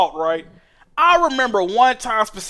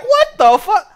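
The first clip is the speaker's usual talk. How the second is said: shocked reaction; 11 semitones higher, 6 dB louder, slower than usual. Retold or imitated. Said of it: "I'm like".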